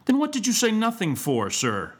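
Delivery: high-pitched scolding, falling at the end; exasperated demand to a pupil; indignant retort. A narrator's reading. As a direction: scold as an outraged schoolmaster. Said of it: "retorted Mister Squeers".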